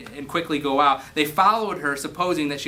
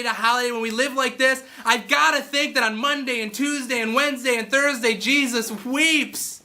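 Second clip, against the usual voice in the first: high, full-voiced sermon; fervent preaching; 8 semitones higher than usual. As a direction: preach it hard to the congregation.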